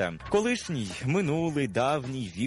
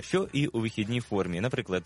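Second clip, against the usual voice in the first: ordinary delivery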